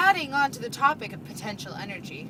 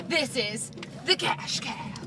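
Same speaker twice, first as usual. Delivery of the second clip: announcer voice; 3 semitones lower, 6 dB quieter than usual. Acted. Game show host intro.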